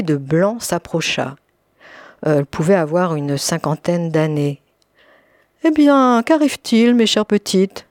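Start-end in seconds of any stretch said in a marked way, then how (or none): 5.6-7.9 falsely smiling. A narrator's reading. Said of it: "demanda-t-elle en souriant".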